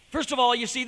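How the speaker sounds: normal talking voice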